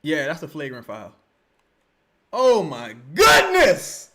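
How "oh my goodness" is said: slow, loud cry of disbelief; appalled fan reaction; shocked exclamation. Real, not acted. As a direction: stretch it out and burst on the last word. Shocked watching the replay.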